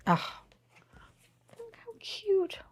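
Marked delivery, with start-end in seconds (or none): 1.6-2.6 high, very soft squeak; barely-there squeal of adoration; tiny hushed squeal. Real, not acted. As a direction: coo softly way up high. Overwhelmed by cute toy.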